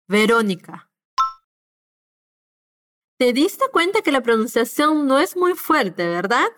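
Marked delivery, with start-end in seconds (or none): 0.1-0.9 Verónica again, soft B; Verónica, lips barely touching. Shown as a demonstration. Teacher repeating the soft B model, gentle. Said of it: "para una B más suave".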